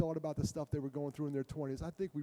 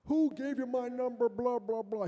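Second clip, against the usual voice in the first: loud, high mock rant of an angry caller; 8 semitones higher, 4 dB louder than usual. Imitating an angry man shouting down the phone. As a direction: play the furious caller, hammed up. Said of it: "he was madder than heck".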